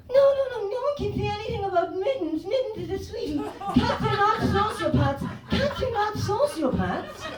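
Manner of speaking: very high, wailing pet-owner voice; doting cat owner. Acted. Comedian mocks cat owners defending Mittens, shrilly indignant. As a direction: protest in a high, tearful squeal. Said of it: "the type of people are like".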